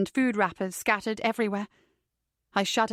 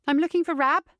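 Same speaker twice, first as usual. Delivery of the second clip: raised, higher-pitched call; 7 semitones higher, 4 dB louder than usual. A narrator's reading. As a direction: call out to someone unseen. Heroine calls into the room.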